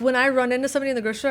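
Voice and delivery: ordinary talk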